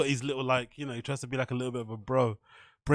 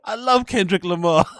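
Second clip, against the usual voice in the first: adoring; 3 semitones higher, 5 dB louder than usual. Retold or imitated. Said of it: "That's his face".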